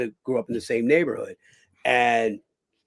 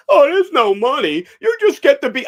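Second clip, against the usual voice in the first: host voiced; 12 semitones higher, 9 dB louder than usual. Host voice, retold.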